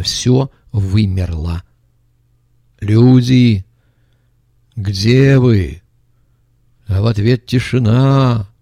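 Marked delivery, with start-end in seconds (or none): 2.8-5.8 loud call into the dark. Retold or imitated. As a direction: shout into empty rooms. Зовёт в темноте. (громкий зов в пустоту)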